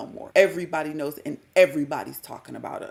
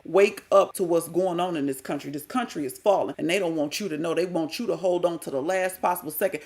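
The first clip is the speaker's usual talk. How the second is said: usual speaking voice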